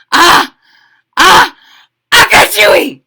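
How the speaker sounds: loud, high build-up to a sneeze; acted sneeze. A narrator's reading. Spice tickles the kitty boy's nose, loud acted sneeze. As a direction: gasp twice, then a big mock sneeze. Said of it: "The kitty boy sneezed".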